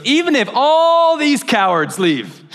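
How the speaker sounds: high, drawn-out mock-heroic vow; bold boasting. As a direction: stretch ALL grandly, imitating a boastful disciple. Peter's boastful vow, acted.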